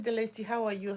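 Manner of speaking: usual speaking voice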